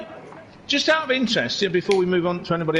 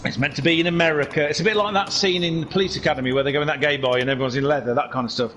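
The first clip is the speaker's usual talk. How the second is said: usual speaking voice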